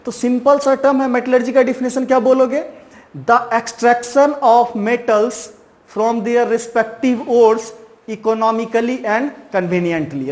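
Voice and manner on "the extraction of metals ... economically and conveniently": very slow, word by word, for note-taking; slow dictation. Shown as a demonstration. Teacher dictating a definition to students, very slow. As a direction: dictate each word so students can write it down. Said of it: "ये definition आप लिख लोगे".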